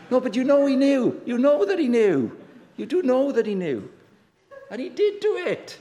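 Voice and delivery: loud, high emphatic insistence; playful insistence. Real, not acted. Preacher to laughing audience about the boy's guilt, loud playful insistence. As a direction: press the point loudly, pitched well up.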